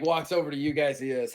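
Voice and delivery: ordinary talk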